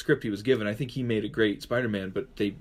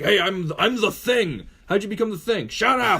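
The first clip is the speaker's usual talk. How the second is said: gruff, higher, louder Thing; mock Thing, louder and higher; Ben Grimm voice; 7 semitones higher, 4 dB louder than usual. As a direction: bark like the Thing. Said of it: "it is like Ben Grimm".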